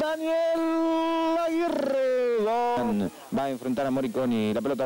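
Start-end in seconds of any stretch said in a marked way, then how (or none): 0.0-3.1 high, excited club and scorer names; high goal call. Real, not acted. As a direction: keep the goal pitch up. Goal aftermath naming.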